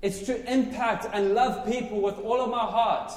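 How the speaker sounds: loud, high, happy proclaiming; joyful shouting. Real, not acted. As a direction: shout it out for joy, not anger. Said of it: "I'm shouting 'cause I'm happy".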